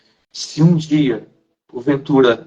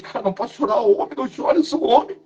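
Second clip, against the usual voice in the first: climbing higher and higher, fighting the tears; holding back tears; 7 semitones higher than usual. Retold or imitated. Retelling telling himself in church not to cry, rising pitch. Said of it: "Naquele momento eu comecei a chorar".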